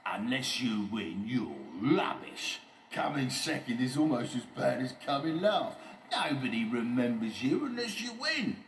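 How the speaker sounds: loud bragging bully voice; Oliver Overdrive, scornful. Acted. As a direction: pour scorn on the losers.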